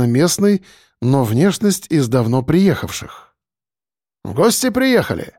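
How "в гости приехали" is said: smiling driver with a hint of a Caucasian accent; light Caucasian accent. A narrator's reading. Driver greets arriving guests, light Caucasian accent. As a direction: ask it lightly, Caucasian lilt. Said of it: "с лёгким кавказским акцентом".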